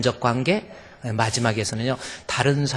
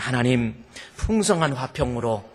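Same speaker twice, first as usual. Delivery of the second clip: closing prayer; 7 dB louder than usual. Real, not acted.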